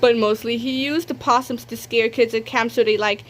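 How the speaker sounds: usual speaking voice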